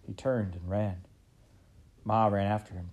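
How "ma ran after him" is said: ordinary delivery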